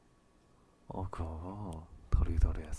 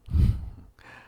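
whispered laugh; 11 dB louder than usual. Acted. Teasing laugh mid-roleplay.

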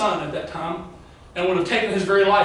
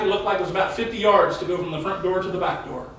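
ordinary talk

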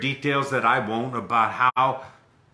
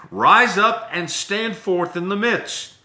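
loud, slow, higher command; commanding Jesus; 7 semitones higher, 6 dB louder, slower than usual. Acted. Preacher reading Jesus commanding the man, loud and slow. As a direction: call it out like an order.

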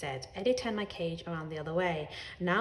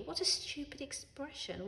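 usual speaking voice

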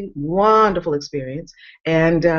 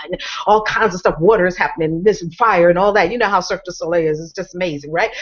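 usual speaking voice

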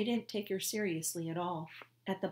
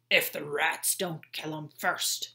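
sneering guard; 5 dB louder than usual. Acted. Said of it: "said Red".

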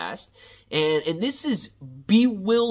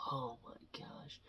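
whispered; 4 semitones lower, 23 dB quieter than usual. Real, not acted. Stunned by the Shib announcement.

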